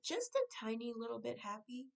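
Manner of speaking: ordinary delivery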